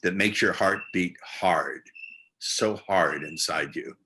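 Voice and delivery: hard, so hard inside, stretched slowly; slow innuendo.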